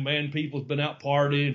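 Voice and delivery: usual speaking voice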